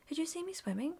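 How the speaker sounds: high, eager little voice; eager boast. A narrator's reading. Roo boasting again.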